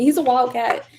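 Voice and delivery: ordinary talk, nothing marked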